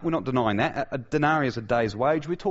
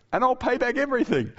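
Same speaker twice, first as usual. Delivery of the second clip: much higher, hammed-up pleading; mock begging; 12 semitones higher, 3 dB louder than usual. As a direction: play a servant begging in a squeaky-high pitch. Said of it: "he begged".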